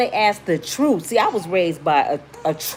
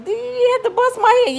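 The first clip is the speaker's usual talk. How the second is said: high, pained whine of the man getting stitches; mock-whiny complaint, way up high; whiny injured man. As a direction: squeal it like a guy in the ER.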